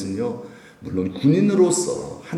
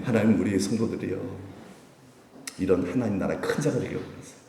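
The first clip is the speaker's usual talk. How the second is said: normal talking voice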